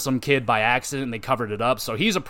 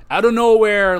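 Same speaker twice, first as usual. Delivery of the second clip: excited; 7 semitones higher, 8 dB louder than usual. Real, not acted. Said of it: "excited retelling".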